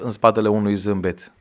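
usual speaking voice

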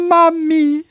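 high, loud wail for mother; moaned cry.

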